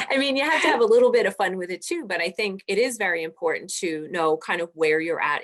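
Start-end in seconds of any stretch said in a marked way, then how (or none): 0.1-2.1 higher, louder, fast, still amused. Real, not acted. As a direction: rush it out, riding the laugh. Right after laughing at joke.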